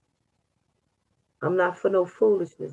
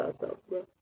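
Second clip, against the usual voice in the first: sleepy, fast mumble; trailing off; 13 dB quieter, much faster than usual. Real, not acted. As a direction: drift off half asleep. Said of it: "'cause I'm sleeping".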